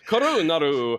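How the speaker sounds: hammed-up Italian, rolled r in Korunaru; bad Italian accent. Shown as a demonstration. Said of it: "a bad Italian accent".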